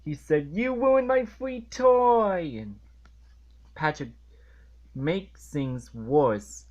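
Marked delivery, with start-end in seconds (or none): 0.5-2.6 put-on high, loud, slow toddler wail; toddler tantrum. SpongeBob retold as a toddler wailing over his broken toy. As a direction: throw a mock toddler fit over the toy. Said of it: "really acted like a toddler".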